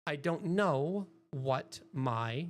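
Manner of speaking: drawn out slowly on purpose; deliberately slow. Shown as a demonstration. Streamer slowing down on purpose for chat, drawn out. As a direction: stretch every word, slowly. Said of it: "yes, I'm doing that deliberately".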